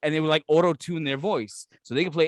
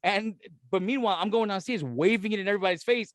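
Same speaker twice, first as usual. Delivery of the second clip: ordinary delivery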